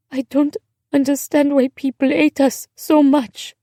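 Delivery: put-on high, tearful young girl's voice; sobbing. A narrator's reading. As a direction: plead in a high voice, on the verge of sobs. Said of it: "she began to sob harder".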